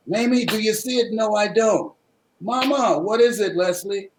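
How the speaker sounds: a hint of a curious little boy; young boy asking. Boy voiced in retold scene.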